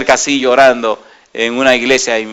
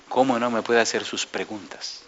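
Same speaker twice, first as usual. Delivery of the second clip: answering in kind; 3 semitones lower, 11 dB quieter than usual. Retold or imitated. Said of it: "yo le respondo igual".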